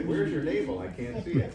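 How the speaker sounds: loud, high, laughing question; loudly hamming up the wrong way; mock loud demand. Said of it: "So I don't say".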